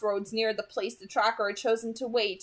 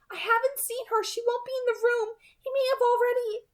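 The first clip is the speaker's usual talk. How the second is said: Alice cautioning; 13 semitones higher than usual. A narrator's reading. Alice warning Edward to stay with them, high and firm. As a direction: warn him in Alice's high voice. Alice voiced in read novel.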